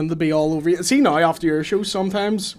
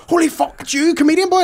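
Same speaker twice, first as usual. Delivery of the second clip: excited fan; 11 semitones higher than usual. Retold or imitated. A fan in the bar toilet, high and excited. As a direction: mimic a starstruck fan.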